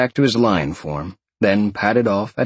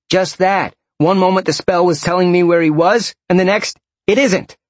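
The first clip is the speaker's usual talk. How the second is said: higher, agitated explanation; defensive henchman; 8 semitones higher, 4 dB louder than usual. A narrator's reading. Tracker explaining the failed spell, high and defensive.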